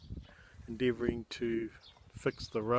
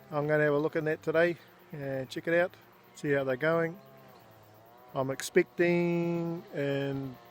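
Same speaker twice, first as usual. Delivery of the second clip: ordinary delivery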